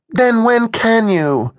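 a touch sulky and put out; slow, lightly huffy question; mildly huffy. A narrator's reading. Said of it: "Alyssa sounded mildly huffy".

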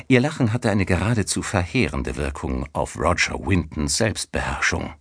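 ordinary delivery, nothing marked

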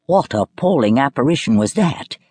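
ordinary delivery

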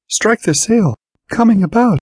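high-pitched sailing command; alarmed skipper's cry; urgent called order. A narrator's reading. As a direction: call it out high, as to a crew in danger. Sailor calls urgent orders on raft.

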